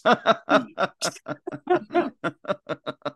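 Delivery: long, high burst of laughter; real laughter. Real, not acted. Reacting to a podcast guest's dark joke, high laughing. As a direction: crack up helplessly at the joke.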